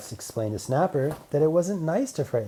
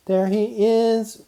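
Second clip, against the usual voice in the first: slow, higher robot hero voice; 11 semitones higher, 7 dB louder, much slower than usual. Acted. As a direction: draw it out as a friendly robot. Robot Man speaks in story.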